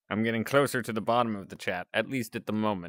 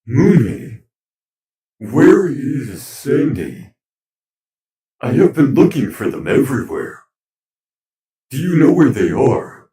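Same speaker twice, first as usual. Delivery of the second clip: loud, higher, filtered character voice; 5 semitones higher, 16 dB louder than usual. Acted.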